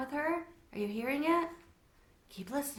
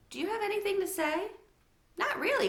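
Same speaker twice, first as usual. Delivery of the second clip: put-on child voices; 12 semitones higher than usual. A narrator's reading. Sophie and Carter dialogue.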